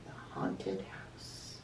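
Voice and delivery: slow, low, breathless whisper; spooky whisper. A narrator's reading. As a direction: whisper it like a ghost story. Spooky reveal in story.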